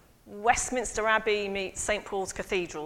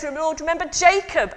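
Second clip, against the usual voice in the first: high, loud priestly cry to the crowd; loud priest call; 6 semitones higher, 6 dB louder than usual. Acted. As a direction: call it out to a packed temple. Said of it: "then the priest says".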